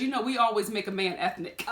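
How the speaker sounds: ordinary talk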